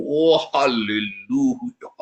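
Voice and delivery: mock-pious drawn-out hallelujah with a quavering tail; orang Farisi berdoa. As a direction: exaggerate a self-important Pharisee praying, stretching every syllable. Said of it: "jangan seperti orang Farisi yang berdoa". (doa orang Farisi yang dibuat-buat, mendayu dan bergetar)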